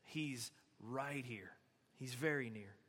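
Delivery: low, soft and slow reassurance; tender, hushed retelling; gentle. Retold or imitated. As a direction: gently reassure them, voice dropped. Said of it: "he's really gently saying".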